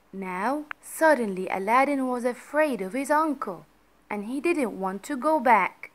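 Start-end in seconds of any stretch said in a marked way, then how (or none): none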